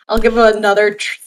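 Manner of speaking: ordinary talk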